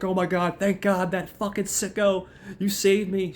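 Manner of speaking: high-pitched, gushing mock relief; imitated relieved woman. Monica thanks Chip as her savior, high gushing imitation. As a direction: put on a high voice for the grateful damsel.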